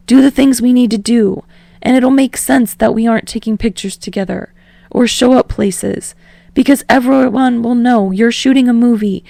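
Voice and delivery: desperate. Acted. Kirishima begging Bakugo not to end it, desperate. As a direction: plead as if losing him. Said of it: "Kirishima says desperately".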